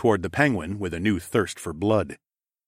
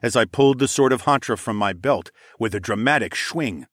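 ordinary delivery

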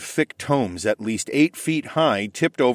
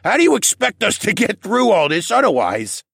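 put-on loud, high old koala; booming drunk elder; 11 semitones higher, 5 dB louder than usual. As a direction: demand it merrily.

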